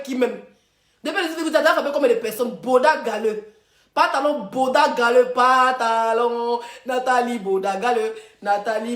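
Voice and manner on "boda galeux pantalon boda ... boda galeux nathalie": pantalon étiré très lentement, moqueur; stretched-out taunt. Acted. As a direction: drag the name out slowly to mock him. Taunting chant of the nickname. (nom traîné lentement pour se moquer)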